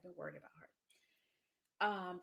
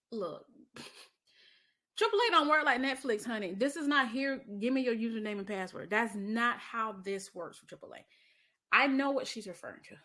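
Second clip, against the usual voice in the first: sassy exasperation; 2 semitones higher, 8 dB louder than usual. Real, not acted. To her podcast co-host, louder and sassy. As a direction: set her straight, honey. Exasperated sass about the Netflix comparison.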